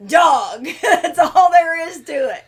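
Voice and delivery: loud, high, laugh-choked words; dying laughing. Real, not acted. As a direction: crack up helplessly while talking. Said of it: "I'm dying laughing".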